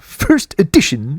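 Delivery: slow, high nerdy cartoon voice; Comic Book Guy voice. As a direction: do the Simpsons comic book nerd. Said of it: "That's the comic book guy".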